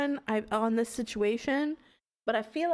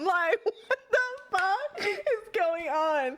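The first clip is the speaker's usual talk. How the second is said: laughing disbelief; 7 semitones higher, slower than usual. Real, not acted. Laughing at game absurdity.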